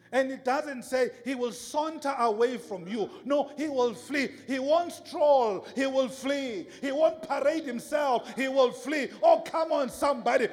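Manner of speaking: high, loud, insistent sermon shouting; fired-up preacher driving the point home; full-force preaching. Real, not acted.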